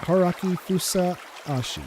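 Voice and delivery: name drawn out slowly, syllable by syllable; slow, spelled-out name. Shown as a demonstration. Boy slowly repeating his own name for the girl. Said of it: "That's it".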